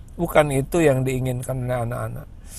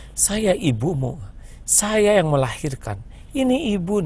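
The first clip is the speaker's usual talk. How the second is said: mock a mother pulling rank, higher; bossy mother; 7 semitones higher than usual. Voices a domineering mother.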